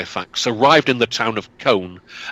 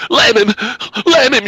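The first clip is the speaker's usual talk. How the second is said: high shrill scream, much louder; screamed order, high and loud; 11 semitones higher, 4 dB louder than usual. Acted. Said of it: "she screamed".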